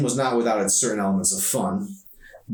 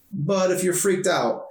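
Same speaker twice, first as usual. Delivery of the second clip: put-on young woman's voice; 11 semitones higher, 3 dB louder than usual. A narrator's reading. Emery voiced high by narrator.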